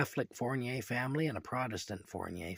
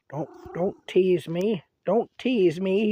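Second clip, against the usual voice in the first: higher, louder, slow begging voice; pleading cat voice; 7 semitones higher, 9 dB louder, slower than usual. Pretend. Man voicing his cat, begging not to be teased. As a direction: beg like a hungry cat. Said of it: "he says".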